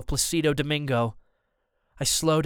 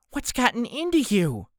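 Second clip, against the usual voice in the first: higher, lightly voiced puzzled mother; a hint of a scolding mom; worried mom; 9 semitones higher than usual. A narrator's reading. Mom asks her panicked son. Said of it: "Mom asks".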